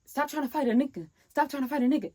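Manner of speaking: slightly high, exasperated warning; retold irritation. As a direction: mimic an irritated friend's repeated warning. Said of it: "Rome was getting irritated".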